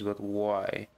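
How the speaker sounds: ordinary talk, nothing marked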